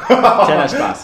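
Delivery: loud, slow laughing aside. Real, not acted. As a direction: laugh it out while speaking. Laughing after a joke.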